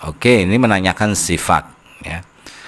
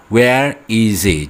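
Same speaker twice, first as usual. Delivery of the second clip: slow model question; much slower than usual. Shown as a demonstration. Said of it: "teacher models the wh-question".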